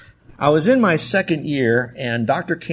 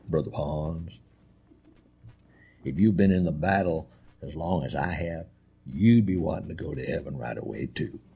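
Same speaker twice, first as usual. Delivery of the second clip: put-on old Tennessee preacher; 9 semitones lower, 7 dB quieter than usual. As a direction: sink deep into a Tennessee preacher's voice. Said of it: "that's how they talk".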